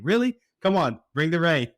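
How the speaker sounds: a sharp high "Really?" melting into a pleading call; exasperated plea. Retold or imitated. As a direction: play the frustrated farmer pleading for rain. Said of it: "all the producers are just looking up saying".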